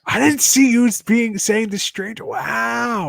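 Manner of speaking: high, drawn-out amazement; astonished. Real, not acted. Reacting to friend's unexpected song choice, astonished. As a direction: exclaim it, floored by the surprise.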